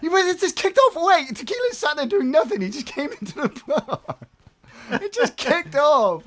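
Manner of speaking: excited. Real, not acted. Laughing disbelief at sudden gunfight.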